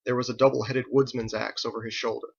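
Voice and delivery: ordinary delivery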